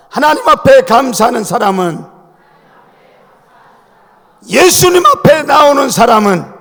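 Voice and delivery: full-force preaching. Acted. Preacher to his congregation, loud rousing sermon call. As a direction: declare it to the whole church.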